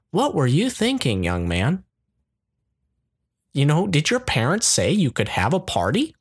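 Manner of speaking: a hint of a scolding mother; mildly voiced let-down mom.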